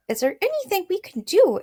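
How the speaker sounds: high, squeaky mouse. A narrator's reading. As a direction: ask eagerly as a little mouse. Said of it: "it asked".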